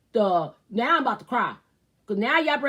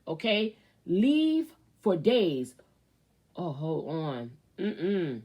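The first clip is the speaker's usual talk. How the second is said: ordinary delivery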